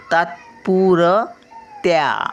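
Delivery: तात्पुरत्या stretched out slowly, half त; slow careful syllables. Shown as a demonstration. Grandmother reading to children, slowly. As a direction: spell out तात्पुरत्या by ear. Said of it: "त थोडासा या".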